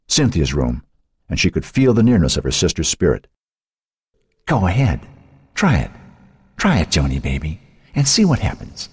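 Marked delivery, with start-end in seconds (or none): 4.5-8.9 goading, lightly voiced challenge; taunting dare. A narrator's reading. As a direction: dare her to try it. Sister daring her to try.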